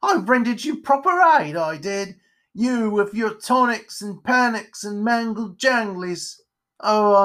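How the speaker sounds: high, aggrieved ranting; crotchety, exasperated character tirade; indignant spider character. Acted. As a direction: rant like an unappreciated nursemaid. Offended spider rants.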